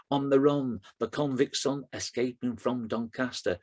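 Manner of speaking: rhythmic, driving performance poetry; poem recitation. Acted. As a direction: recite it to a steady beat, hitting the rhymes.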